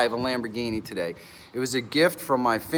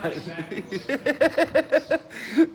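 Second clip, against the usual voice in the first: long high laugh; real laugh; 10 semitones higher than usual. Real, not acted. Goofy host laughing at herself for the stream. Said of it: "laughing a lot".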